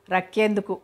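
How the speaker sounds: ordinary delivery, nothing marked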